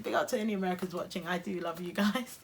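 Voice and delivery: normal talking voice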